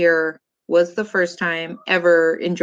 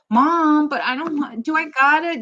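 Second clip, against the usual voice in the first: put-on higher, whiny kid getting the last word; kid talking back; 8 semitones higher than usual. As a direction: whine like a kid arguing back. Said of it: "having to have the last word".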